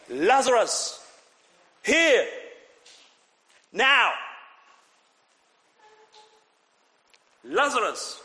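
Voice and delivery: shouted commands, one word at a time; megaphone shout. Acted. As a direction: bark each word as an order into the grave. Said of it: "he shouted like a megaphone".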